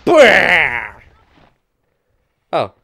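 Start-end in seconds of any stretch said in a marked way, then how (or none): 0.0-1.0 loud, high "bwah"; loud blast noise. Acted. Player blasting an enemy, explosive vocal noise. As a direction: burst out with a drawn blast sound.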